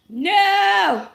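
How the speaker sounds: loud, sharp high no; sudden no. Real, not acted. Streamer blurting a loud no at the room. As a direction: cut it off with a loud no.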